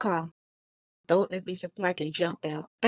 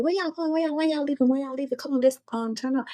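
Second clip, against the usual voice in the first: homeboys pleading; 7 semitones higher, faster than usual. Retold or imitated. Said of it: "they like".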